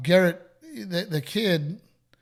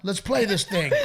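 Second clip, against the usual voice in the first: raised, half-laughing mock exasperation; mock frustration; 6 semitones higher, 6 dB louder than usual. Dad imitating his son at mini golf, mock frustrated. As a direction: imitate a frustrated little kid.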